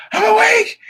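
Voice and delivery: very high, loud, strained shriek of relief; dad at the mirror, shrieking with joy; ecstatic shriek. Retold or imitated. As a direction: screech it, overjoyed to be alive. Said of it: "He looked in the mirror".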